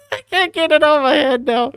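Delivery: high squeal through leftover laughter; high, giggle-choked voice; laughing hard. Real, not acted. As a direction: barely get it out for laughing. Helpless laughing over meme.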